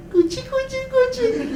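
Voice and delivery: put-on very high sing-song baby talk. Shown as a demonstration. A lecturer cooing to an imagined baby in very high baby talk. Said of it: "when you do things like".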